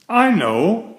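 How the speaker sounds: high bright exclaim of an idea. A narrator's reading. Beaver struck by an idea, exclaiming. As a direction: burst out with the idea. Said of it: "she exclaimed".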